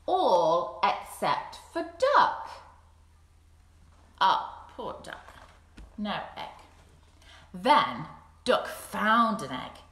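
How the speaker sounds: slow, deliberate storytelling; story narration. A narrator's reading. Teacher reads The Odd Egg to children, slow storytelling. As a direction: let each line land slowly.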